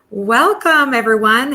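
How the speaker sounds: ordinary talk, nothing marked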